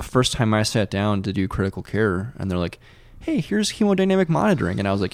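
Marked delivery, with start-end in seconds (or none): 3.2-4.8 high-pitched mock instructor; imitating an upbeat teacher; chirpy instructor. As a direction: breezily announce it, pitched well up. Voicing instructors introducing a topic.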